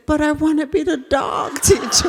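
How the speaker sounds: put-on a small boy cheerfully volunteering; happy little boy. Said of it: "little boy looked at me and said".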